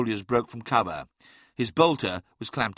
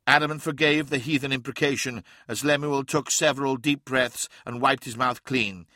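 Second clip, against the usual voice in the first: usual speaking voice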